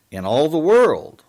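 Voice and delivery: loud, high mock bellow; Menachem Begin bellowing. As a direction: bellow it out, imitating a boastful quote. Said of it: "Begin bellowed in his thick cartoon accent".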